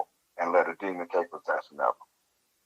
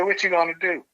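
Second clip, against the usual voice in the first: boss's questioning; 10 semitones higher than usual. Retold or imitated. Boss's retold question, voiced high.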